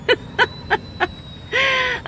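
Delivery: high breathless laughter. Real, not acted. Laughing fit.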